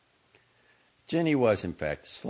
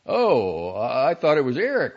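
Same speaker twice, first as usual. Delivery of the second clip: startled; 8 semitones higher, 11 dB louder than usual. A narrator's reading. Startled brother bursts in.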